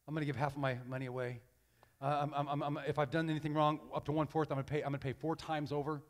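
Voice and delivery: low, soft, hurried stammer; understated pledge. Acted. Zacchaeus giving half his money away, low hurried pledge. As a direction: toss off the promise quietly and quickly.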